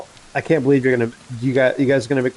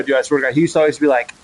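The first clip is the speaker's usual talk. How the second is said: usual speaking voice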